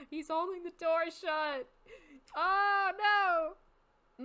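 loud, high cry of dismay; rising into a wail as the scare gets worse; dismayed outcry. Real, not acted. Player reacting to door held shut, dismayed outcry.

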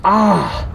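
loud, high cry of fright; startled scream. Real, not acted. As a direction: yelp as if just hit. Hit by something in game.

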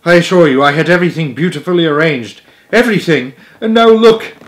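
acted upset, building to a loud high cry; trembling voice. A narrator's reading. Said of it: "said Stuart in a trembling voice".

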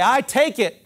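call to repeat. Shown as a demonstration. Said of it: "Everybody say".

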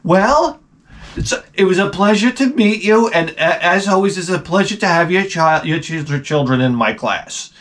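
high, sugary polite teacher voice. Retold or imitated. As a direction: sweetly thank the parents. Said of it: "she's like".

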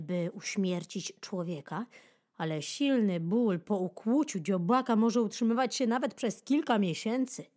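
ordinary delivery, nothing marked

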